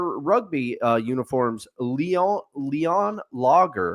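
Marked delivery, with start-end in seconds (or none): 1.8-4.0 Leon Lager said with a mock French lilt, LEE-on; a playful French flourish on Leon Lager; French accent. Mock French pronunciation while naming the sponsor beer. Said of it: "I don't know why I went French there".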